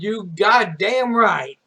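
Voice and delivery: loud, drawn-out retold exclamation; emphatic agreement. Graduate agrees with principal about happy tears, loud and emphatic. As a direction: belt it out slowly with relish.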